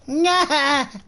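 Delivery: high, failed cartoon laugh; SpongeBob. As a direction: attempt a high-pitched cartoon cackle. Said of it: "I can't do my SpongeBob voice".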